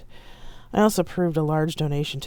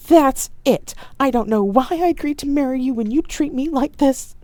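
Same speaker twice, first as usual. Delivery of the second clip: loud, higher, hammed-up fury; staged outburst; 11 semitones higher, 7 dB louder than usual. A narrator's reading. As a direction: throw a theatrical tantrum. Staged lovers' quarrel, Ibo storms.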